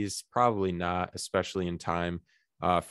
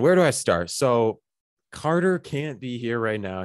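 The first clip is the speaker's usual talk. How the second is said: ordinary talk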